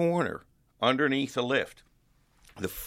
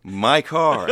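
louder, higher show-off line, played for laughs; mock gloating; 4 semitones higher, 7 dB louder than usual. Rehearsing a prank on the car's former owner, playfully gloating. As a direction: rub it in playfully, landing hard on the last words.

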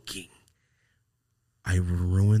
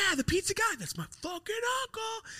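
put-on proud kid brag; 4 dB quieter than usual. Bragging about uncle at school.

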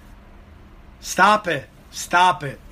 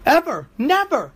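much higher, emphatic rhetorical outburst; incredulous rant; 10 semitones higher than usual. Real, not acted. Comic ranting to the audience, high and emphatic. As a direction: hammer home that it never goes well.

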